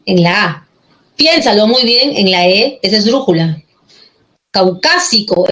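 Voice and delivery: ordinary delivery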